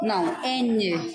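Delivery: Não, N drawn out slowly; slow down to correct the child; slow correction. Shown as a demonstration. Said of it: "Lá". (correção lenta e marcada)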